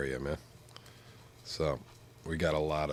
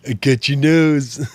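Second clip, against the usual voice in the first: even higher, louder and slower nasal goof; silly nasal voice; 8 semitones higher, 12 dB louder, slower than usual. Pretend. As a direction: stretch the stuffed-nose joke bigger. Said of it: "somebody's pinching my nose".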